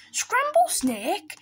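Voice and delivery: high, drawn-out shocked question; slow, horrified snake, pitch rising; alarmed echo. Acted. Snake hears it is the favourite food, alarmed.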